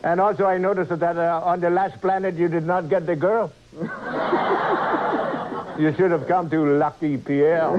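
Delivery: put-on comic French-accented ladies' man. Engineer teases captain over the girl, French accent. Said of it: "they picked a different accent".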